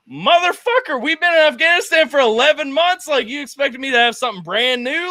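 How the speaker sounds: high, exasperated complaint; indignant rant. Retold or imitated. Soldier protesting to his first sergeant, indignant. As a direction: pitch it up in disbelief.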